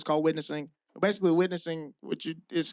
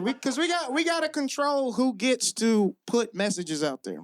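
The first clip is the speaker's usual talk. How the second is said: ordinary delivery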